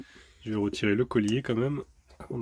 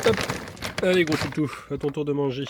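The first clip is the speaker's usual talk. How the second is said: higher, coaxing pet voice; talking to a dog; 4 semitones higher than usual. Real, not acted. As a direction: coax the dog to eat. Calling the dog to eat. (voix câline pour le chien)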